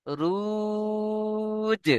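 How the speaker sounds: রুজ chanted long and high, with a bounced জ at the end; qalqala recitation. Shown as a demonstration. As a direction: intone রুজ slowly, then knock the জ. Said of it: "জিমকে ধাক্কা দিতে হবে".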